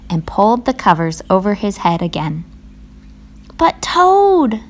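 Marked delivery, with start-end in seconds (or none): 3.6-4.6 high, pleading protest; crying out. A narrator's reading. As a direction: protest in a high voice, name drawn out. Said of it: "cried Frog".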